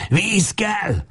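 high cry for water; hoarse shout. Acted. Lajos crying out at the woman's door: high desperate shout. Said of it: "kiáltja rekedten".

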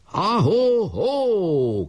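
high, long drawn-out halloo; shouted whoop. A narrator's reading. Trumpeter answering his leader's order, shouting. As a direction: stretch the call out high and slow. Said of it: "kiáltott Kolnai".

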